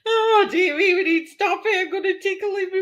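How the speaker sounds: barely able to speak for laughing; crying with laughter. Real, not acted. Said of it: "I'm crying".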